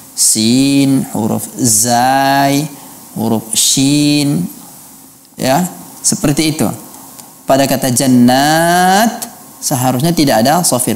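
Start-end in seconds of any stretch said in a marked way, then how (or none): none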